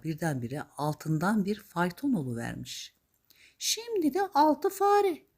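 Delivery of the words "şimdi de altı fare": very high, slow magical voice; high-pitched fairy calling for items; fairy godmother. Acted. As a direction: draw out the order in a high voice. Fairy godmother giving orders.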